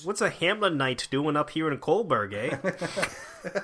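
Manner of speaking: put-on playful Canadian accent, rising eh; hammed-up Canadian accent, laughing at end. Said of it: "Your Canadian accent is much better".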